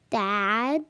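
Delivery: "Daddy" drawn out loud, second syllable rising; over-articulate "Daddy" for a learner; loud, stretched "Daddy", syllable by syllable; exaggerated mouthing. Shown as a demonstration. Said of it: "Watch my mouth, how my, it moves".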